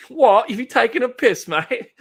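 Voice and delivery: high, loud mock British indignation; hammed-up incredulous Brit, high and loud; put-on British accent. Streamer reacting to chat's comment, mock-indignant British accent. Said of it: "approving of my British accent".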